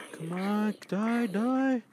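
very high excited yelling; gamer battle cry. Acted. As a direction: urge the spider to die, high.

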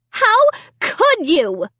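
high, slow, hurt demand; slow accusation. A narrator's reading. Sarah repeating the accusation, high and slow. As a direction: drag out the accusation.

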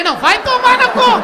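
high, very loud, hammed-up rage; furious outburst. Reacting to her complaint about clothes, hammed-up fury. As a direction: explode in comic outrage.

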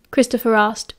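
ordinary delivery, nothing marked

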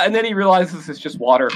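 normal talking voice

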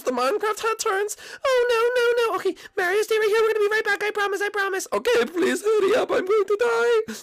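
high whimpering, near tears; high pleading whimper; high crying panic. Acted. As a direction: wail that it's all over. Crying characters fret over Mario.

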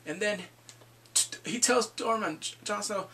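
ordinary talk, nothing marked

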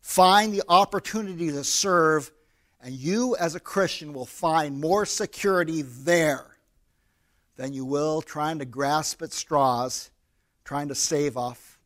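usual speaking voice

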